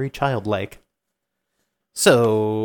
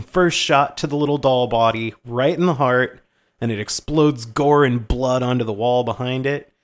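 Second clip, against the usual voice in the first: ordinary delivery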